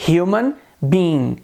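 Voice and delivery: slow "human being" with a breathed H; "human" said slowly, H like Portuguese R; sounded H. Shown as a demonstration. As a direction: stretch out "human being", H clearly audible. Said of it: "o som do H como função de R".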